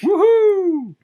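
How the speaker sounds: loud, very high party whoop; whooping cheer. Pretend. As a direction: cheer like you're on the dance floor. Cheering at the nightclub dancing.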